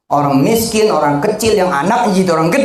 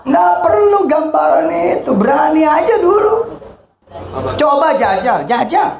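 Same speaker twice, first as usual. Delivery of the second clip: rousing shout; 12 semitones higher than usual. Real, not acted. Preacher urging the congregation.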